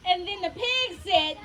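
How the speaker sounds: plain reading voice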